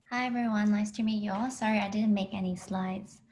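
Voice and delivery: normal talking voice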